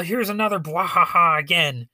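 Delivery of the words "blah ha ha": mustache twirling villain. Pretend. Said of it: "mad mustache-twirling doctors".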